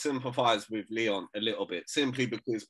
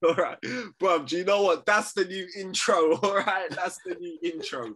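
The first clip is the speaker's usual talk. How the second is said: laughing taunt; 6 semitones higher than usual. Real, not acted. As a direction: cackle while taunting the Brum viewers. Taunting Birmingham viewers, laughing.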